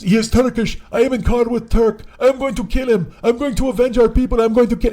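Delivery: put-on drunk passenger screaming to his wife on speakerphone; accented screaming. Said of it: "he starts screaming it".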